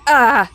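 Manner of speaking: hammed-up alarm; mock alarmed gasp. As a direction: cry out like a worried parent. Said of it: "And he'd be like".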